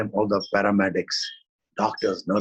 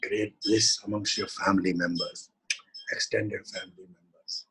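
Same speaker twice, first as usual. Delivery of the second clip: prayerful invocation; slower than usual. Acted. Teacher leading the group in closing prayer, devotional. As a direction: call on Shiva and Shakti in prayer.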